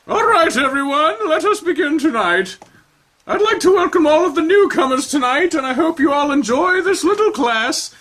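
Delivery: high, theatrical grande dame; high showy dance teacher; Madame Esperanza. Acted. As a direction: gush like a flamboyant instructor. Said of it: "instructor addresses class".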